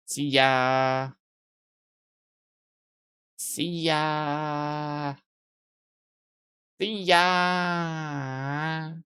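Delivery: long, held-out vowels on a steady note; drawn-out goodbye. Pretend. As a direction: stretch the farewell out endlessly, playfully. Drawn-out repeated joke goodbyes.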